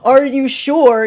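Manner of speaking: drawn-out, loud, skeptical "are you sure"; a loud, slow, teasing challenge; mock doubtful challenge. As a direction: slowly question their certainty, teasing. Universe voiced asking.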